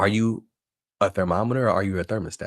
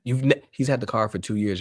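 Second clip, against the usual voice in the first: usual speaking voice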